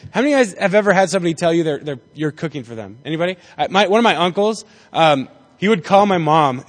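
ordinary talk

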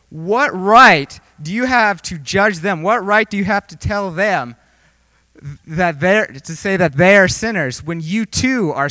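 loud, indignant challenge with drawn-out stress; Jesus scolding the judgmental; angry rebuke, acted. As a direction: take them to task, hitting each key word. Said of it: "gets angry at you".

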